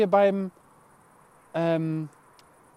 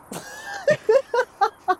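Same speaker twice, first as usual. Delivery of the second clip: high laugh. Real, not acted.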